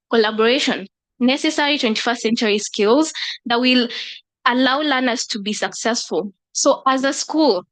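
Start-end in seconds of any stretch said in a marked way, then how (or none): none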